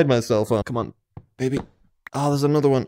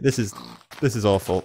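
normal talking voice

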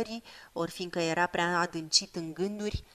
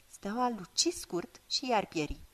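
plain reading voice

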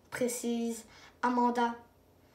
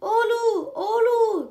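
high, loud alarm cry; shouting; 8 semitones higher, 5 dB louder, slower than usual. Acted. Children shout to wake the shepherd, loud alarm. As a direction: yell for help at the wolf. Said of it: "crient tous les enfants".

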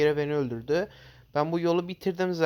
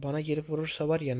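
normal talking voice

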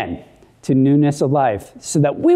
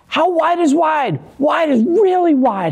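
higher and lively, leaning into "wide"; excited teacher; 9 semitones higher than usual. Shown as a demonstration. Urging children to stretch their arms, bright and excited. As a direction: pump up the kids to stretch wide. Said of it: "Can I see some arm movement".

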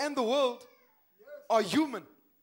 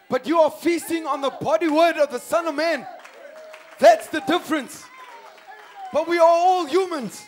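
loud, driving pulpit delivery; full-force preaching; 4 semitones higher, 10 dB louder than usual. Acted. Preacher urging the congregation beyond the chicken yard, full-force preaching.

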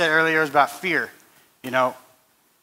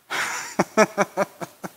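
amused laugh; 5 semitones higher than usual. Pretend. Laughing after the devil quote.

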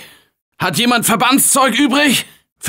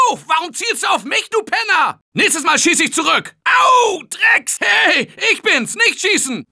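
high, indignant yelling at a teammate; furious at being shot by a friend; angry complaint; 9 semitones higher than usual. Acted. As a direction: tell off the one shooting you.

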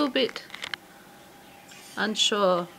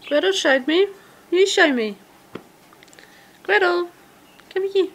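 high, sing-song coaxing to a bird; pet talk voice; 10 semitones higher, 5 dB louder than usual. Real, not acted. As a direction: coax a shy budgie out. Coaxing budgies to the door.

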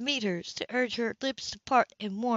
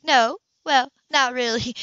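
louder, higher, caught off guard; flustered admission; 4 semitones higher, 8 dB louder than usual. A narrator's reading. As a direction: blurt it out, then backpedal. Flustered while being kissed.

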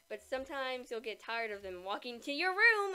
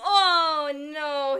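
laughing dismay; 13 dB louder than usual. Real, not acted. Friend just lost.

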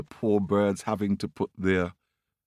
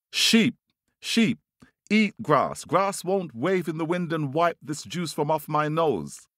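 high, insistent call for help; pleading bird; 8 semitones higher than usual. Acted. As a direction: chant the demand in a pitched-up voice.

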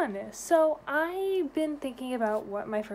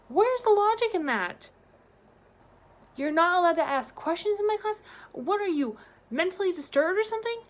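much louder and higher, indignant rant; outraged; 8 semitones higher, 6 dB louder than usual. Real, not acted. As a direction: let it out, furious at the absurdity.

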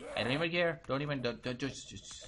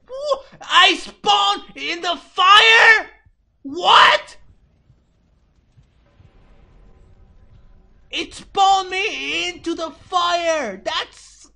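stunned scream at the screen, much louder and higher; outraged disbelieving shout; 13 semitones higher, 13 dB louder, much slower than usual. Real, not acted. Gamer shouting after respawning into fire, outraged disbelief. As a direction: yell it out, high and slow, in shock.

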